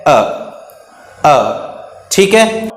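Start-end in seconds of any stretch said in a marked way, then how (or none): 0.1-1.3 अ as a very brief uh; short vowel sound. Shown as a demonstration. Teacher demonstrates the short sound of U, brief अ. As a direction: cut अ short, each on its own. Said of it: "इसका short sound है".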